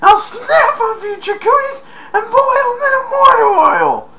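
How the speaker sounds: very high, loud, cartoonish shouted threat; furious burglar threat. Burglar threatening the kid, high mock shout. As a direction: scream the threat like a crazed villain. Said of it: "And I think he said".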